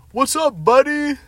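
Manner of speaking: high, loud mock-friendly yell across the pool; loud fake-friendly holler; yelled greeting. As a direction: shout it like a drunk buddy. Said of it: "And, like, yells".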